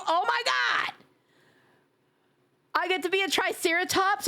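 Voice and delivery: high delighted gasp of surprise; excited surprise. Real, not acted. Streamer thrilled by a game egg, high excited surprise.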